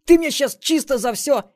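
loud, high angry thug tirade; furious outrage. Acted. Outraged marauder accusing madam, loud high fury. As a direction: yell accusations at the top of your voice.

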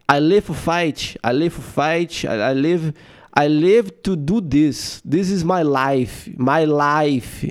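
put-on halting English with thick Brazilian accent; Brazilian speaking English. Brazilian champion in post-fight interview, thick accented English. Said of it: "quando o, o brasileiro, ele fala porra".

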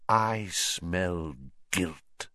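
low, soft, very slow menace; hushed, drawn-out accusation; sinister villain. Acted. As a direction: draw it out slowly, low and menacing. Villain sniffs and accuses his followers.

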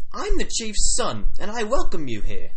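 loud, booming mock-Australian welcome; Australian-British accent. Chief's son welcoming visitors, loud Australian-British accent. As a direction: proclaim it loudly in a muddled British-Australian accent. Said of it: "turned into an Australian accent to a British accent".

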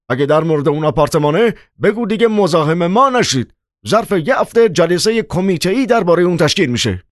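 irritated outburst, high-pitched and agitated; guard-dog growling. A narrator's reading. Chairman sends away dinner-time callers, high irritated outburst. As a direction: snap angrily at unwelcome callers in a high, strained voice. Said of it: "مثل سگ پاسبانی قرید".

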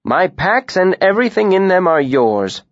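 a cheerful, lively tinker. A narrator's reading.

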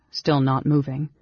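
ordinary delivery, nothing marked

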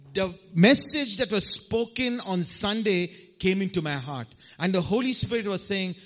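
ordinary delivery

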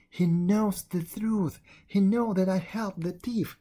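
agony of terror. A narrator's reading. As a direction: gasp it out in panic. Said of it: "cried Jihwa in an agony of terror".

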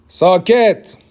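high, loud furious roar; roaring command. Acted. Emperor roars at the hall for silence. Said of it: "نعره زد و سکوت تالار را شکست".

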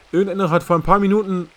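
high and loud, pushing on. A narrator's reading. Langdon urgently explains his friend's danger, high and loud. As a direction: press on, alarmed.

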